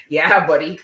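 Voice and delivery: loud laughing cheer. Real, not acted. Cheering the pull for viewers with a laugh. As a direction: cheer it out then burst into laughter. Excited at the card draw.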